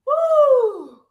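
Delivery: high, loud whoop of energy; excited woo. Real, not acted. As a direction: let out a big cheering woo. Coach cheering mid-workout.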